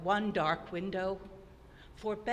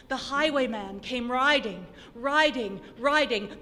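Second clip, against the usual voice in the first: swelling recital; 3 semitones higher, 7 dB louder than usual. A narrator's reading. Rider approaching, recital swells.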